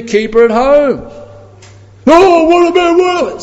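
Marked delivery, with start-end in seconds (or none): 2.1-3.4 high, loud mocking whine; high mock-indignant protest voice. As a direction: mimic the objectors in a squeaky voice. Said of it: "So just calm down".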